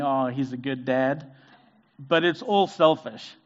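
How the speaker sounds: normal talking voice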